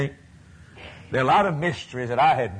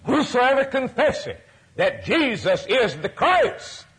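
high scripture quote; 11 semitones higher than usual. Acted. Preacher quoting scripture, rising.

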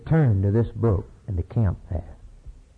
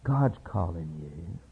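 gentle hushed Eli; 6 semitones lower, 7 dB quieter, slower than usual. Retold or imitated. Eli tells Samuel gently.

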